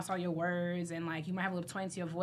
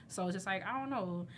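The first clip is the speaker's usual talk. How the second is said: ordinary delivery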